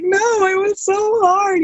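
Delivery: high, slow sobbing wail; mock wailing cry. Runner sobbing to her partner why she is crying. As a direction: stretch out the no like a hammed-up sob. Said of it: "bawling, just crying".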